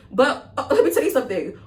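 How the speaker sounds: laughing through the words. Real, not acted. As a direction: chuckle while starting the story. Laughing before a juicy story.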